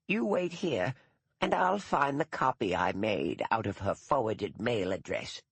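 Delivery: put-on a stiff elderly schoolmistress, proud and put out; dour old retired teacher. Gaddis goes to fetch the address.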